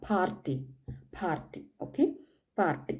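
Party with the r slightly stressed; German Party, r stressed. Shown as a demonstration. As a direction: lean a little on the r in party. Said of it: "അതിനെ കുറച്ച് stress ചെയ്ത് പറയാം".

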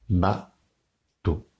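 Bateau, slow, ba and teau apart; split into syllables. Shown as a demonstration. Teacher to class, Bateau broken into syllables. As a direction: beat out Bateau in two. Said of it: "Un doigt pour bas".

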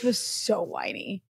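slow, lightly mocking whine; whiny. Insisting to co-host about a politician's voice, lightly whiny. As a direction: draw it out with a hint of whine. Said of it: "that whiny Joe Lieberman voice".

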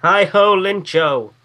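playful call. Pretend.

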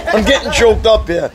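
joking through a laugh. Pretend. Preacher joking to the congregation about choking up, laughing. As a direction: laugh while pretending to be choked up.

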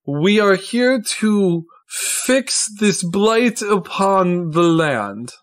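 slow, measured theatrical oration; orating Tristan, grave. Acted. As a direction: proclaim a city's suffering to the assembled guests. Tristan's grand speech to the ball.

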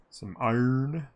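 "iron" with Australian dropped r, drawn up; Aussie accent. Acted. Streamer hoping for iron, playful Australian accent.

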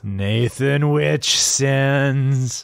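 slow, drawn-out ghostly moan; spooky voice. Acted. Echoing donor name spookily.

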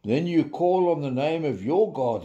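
ordinary talk, nothing marked

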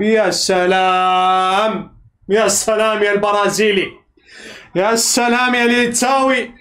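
a long, delighted sigh of praise; slow admiring sigh. Real, not acted. Fan savouring the Brazilian's goal, slow and low.

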